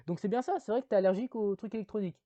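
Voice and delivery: lightly voiced lawyer cross-examining; Jimmy's question. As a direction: needle him with the question. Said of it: "Jimmy lui fait".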